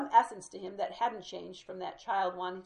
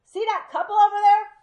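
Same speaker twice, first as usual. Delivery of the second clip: a booming, much higher shout across the store; loud-ass voice; 13 semitones higher, 18 dB louder than usual. Retold or imitated. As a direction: call out with no volume knob. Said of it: "in what you might call a loud-ass voice".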